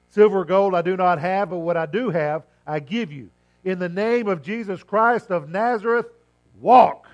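booming command to rise. Acted. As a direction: proclaim it with full authority. Preacher quoting Peter's healing command.

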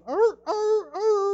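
very high imitated rooster crowing. Preacher mimicking the rooster's crow, very high crowing. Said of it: "he'll continue to crow in your life".

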